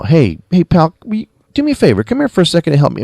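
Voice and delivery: high, quick, falsely friendly request; mock passive-aggressive. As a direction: imitate a psychopath asking nicely, no anger. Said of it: "he's being very passive-aggressive".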